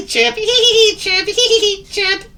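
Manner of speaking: very high, loud bird giggles between chirps; giggling and chirping. A narrator's reading. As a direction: alternate squeaky laughs and chirps. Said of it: "giggling and chirping at the same time".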